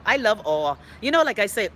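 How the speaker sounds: normal talking voice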